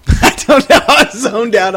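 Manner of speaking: loud laughing admission. Real, not acted. Laughing at own inattention.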